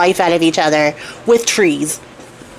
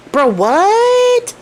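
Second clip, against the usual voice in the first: much higher, incredulous "what"; shocked disbelief; 11 semitones higher than usual. Retold or imitated. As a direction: squeak it out in utter disbelief. Soldiers stunned seeing tailor return.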